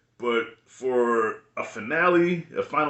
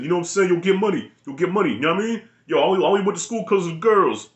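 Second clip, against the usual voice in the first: higher, louder imitation of a thick New York accent; 6 semitones higher, 3 dB louder, faster than usual. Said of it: "he would talk with a thick New York accent".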